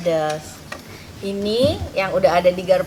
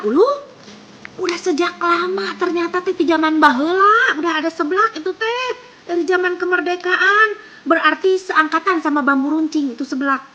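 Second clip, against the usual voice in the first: amazed; 8 semitones higher than usual. Real, not acted. Amazed reaction to seblak history.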